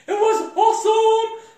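loud, high, sung-out delight; exhilarated. Real, not acted. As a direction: belt it out with thrilled emphasis. Thrilled retelling of the turn.

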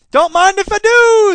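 very high, loud, drawn-out glee; gleeful homeless guy. Acted. Homeless guy snatching up both burgers, loudly gleeful. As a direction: sing out with greedy delight, high and slow.

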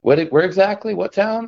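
imitating pressing questions, pitched high; high, rising mock interrogation; retold urgent questioning. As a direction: act out someone needing the details now. Officer presses for the trap's location.

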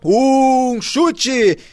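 high, loud, stretched goal shout; explosive goal commentary. Real, not acted. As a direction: shout it out in triumph. Replay of the goal call.

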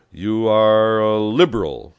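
slow, loud, drawn-out question; incredulous challenge. A narrator's reading. Communist leader sizing up his liberal passenger, incredulous. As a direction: stretch it out in loud disbelief.

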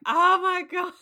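high, loud burst of disbelief; shocked oh my God. Retold or imitated. Recalling watching the scene, a loud high shocked gasp. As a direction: blurt it out, stunned and laughing.

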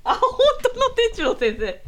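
high excited 本当の伝次郎先生; surprised. Real, not acted. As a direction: gasp at 本当の with delight. Surprise at seeing him.

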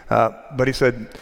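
ordinary delivery

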